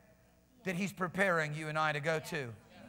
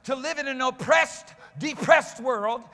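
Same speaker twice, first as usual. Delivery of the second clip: raised, emphatic pulpit voice; full-force preaching; 5 semitones higher, 7 dB louder than usual. Real, not acted. Preacher decrying an oppressed, sedated world, forceful preaching. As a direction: drive each weighty word home.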